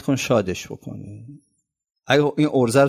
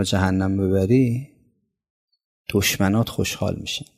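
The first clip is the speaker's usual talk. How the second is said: prayer; 4 semitones lower than usual. Acted. Quoted supplication in sermon.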